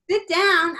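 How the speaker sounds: high, loud echo in a silly character voice; It's Pat character voice. Acted. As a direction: squeal it back, still in character. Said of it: "And I went".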